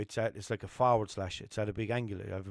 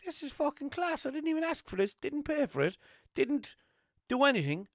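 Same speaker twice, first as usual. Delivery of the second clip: delighted inner thought; 14 semitones higher than usual. Retold or imitated. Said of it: "I was thinking".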